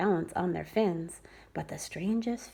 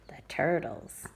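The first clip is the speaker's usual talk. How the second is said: hushed whispered title; 5 semitones lower than usual. Acted. Reader whispering the turtles heading to a child.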